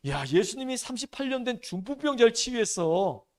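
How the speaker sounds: loud, eager gossip among friends; excited bragging disciples. Pretend. Disciples boasting to each other about Jesus' miracles, loud and excited.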